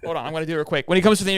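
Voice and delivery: usual speaking voice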